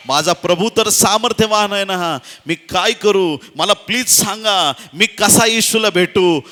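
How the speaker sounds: high, loud impassioned pleading; loud desperate plea. Acted. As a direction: cry out urgently for someone to help. Acted desperate plea to reach Jesus.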